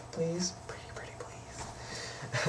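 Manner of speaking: slow, playful begging whisper; whispered pleading. Pretend. Vlogger begging viewers for five stars, pleading whisper. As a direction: whisper it slowly, coaxing.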